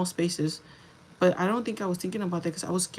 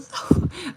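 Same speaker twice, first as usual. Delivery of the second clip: sudden laugh; 11 semitones higher, 6 dB louder than usual. Real, not acted. Laughs mid-story at her own retelling.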